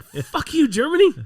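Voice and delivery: high, loud fed-up outburst; mock outraged shout. Traveller cursing Germany after the police check, loud and high. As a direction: yell it at the whole country. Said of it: "I was like".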